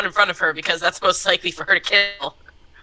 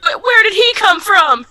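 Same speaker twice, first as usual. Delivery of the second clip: surprised; 12 semitones higher, 6 dB louder than usual. Real, not acted. Surprised at rat appearing.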